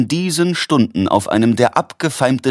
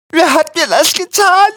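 very high, louder agonised whimper; wimmernd; 5 dB louder than usual. A narrator's reading. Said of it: "wimmerte Quillipi". (sehr hoch und laut wimmernd)

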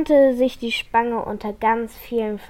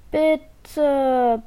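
begging; 4 semitones higher, 5 dB louder than usual. A narrator's reading. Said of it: "bettelt Jola".

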